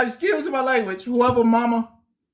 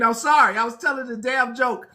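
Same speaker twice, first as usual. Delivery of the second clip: ordinary talk, nothing marked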